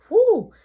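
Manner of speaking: high, loud astonished whoo. Retold or imitated. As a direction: let out a big shocked whoo. Manager reacts to big order.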